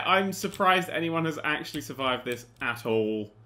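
ordinary talk

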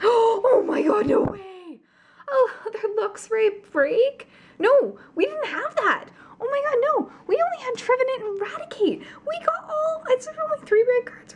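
gasping, high squeal of disbelief; shocked excitement. Real, not acted. Opener stunned by an unexpected break card, shocked excitement. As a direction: squeal it far above normal, almost tearful with joy.

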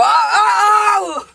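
high, loud drawn-out whoop; shouted call. Pretend. Hider giving away the hiding place, shouted whoop. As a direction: belt it out from the hiding spot.

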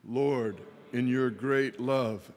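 ordinary talk

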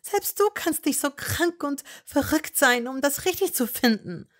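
high, loud desperate plea. A narrator's reading. Hermine desperately pleading with Malfoy. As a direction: beg him to see sense. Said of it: "sprach sie verzweifelt weiter".

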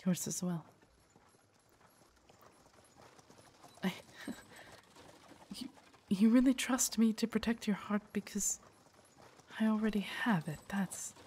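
soft, halting wonder; hushed, touched disbelief; moved, tender awe. Acted. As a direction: speak softly, overwhelmed by trust. Guard moved by lady's trust.

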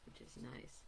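soft unvoiced echo of the phrase; whispering. Real, not acted. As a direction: whisper the repeat to yourself. Repeated phrase, second one whispered.

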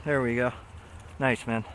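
ordinary talk, nothing marked

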